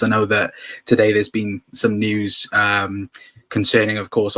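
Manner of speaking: normal talking voice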